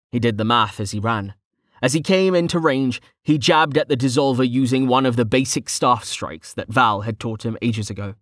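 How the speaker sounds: ordinary delivery, nothing marked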